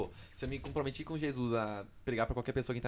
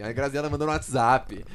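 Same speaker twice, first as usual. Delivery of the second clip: usual speaking voice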